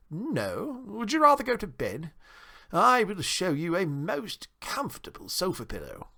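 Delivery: high, gracious host voice; grand, high-pitched town mouse; Johnny Town Mouse, solicitous. A narrator's reading. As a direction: offer hospitality in a high, refined voice.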